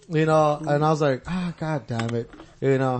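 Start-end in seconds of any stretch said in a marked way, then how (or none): 1.3-2.3 slow, low, soft sigh of a curse; weary exasperation. Retold or imitated. Inmate recalling trouble brewing again, weary exasperation. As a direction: resign yourself quietly to coming trouble. Retells dread as trouble starts.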